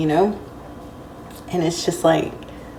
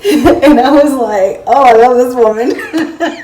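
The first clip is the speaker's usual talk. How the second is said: laughing; 8 semitones higher, 11 dB louder than usual. Real, not acted. Delighted by grandmother's reply.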